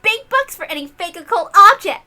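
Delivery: gloating. Acted. Gloating over the swindled Kinzo.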